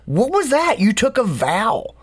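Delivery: an outraged monk scolding his companion; snapping accusation. Retold or imitated. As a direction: berate him for breaking the vow. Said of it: "and he snaps".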